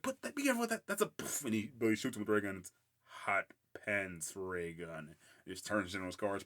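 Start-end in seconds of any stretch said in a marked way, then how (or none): 0.0-1.4 high, fussy mock girls; pitched-up mock warning; high cartoon girls' voice. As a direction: imitate cartoon girls fussing. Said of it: "those girls like".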